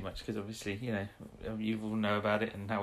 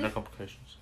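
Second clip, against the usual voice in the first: deadpan Ogron voice; 2 semitones lower, 7 dB quieter than usual. Retold or imitated. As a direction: drop it flat like a bored henchman. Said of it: "That's the way he says it".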